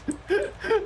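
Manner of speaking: squealing, high burst of laughter. Real, not acted. Laughing in the middle of mocking the students. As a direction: break into giggles mid-line.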